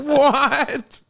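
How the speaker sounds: high, loud shocked laughing what. Pretend. Worker told by boss, shocked and laughing. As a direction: squeak out a stunned what.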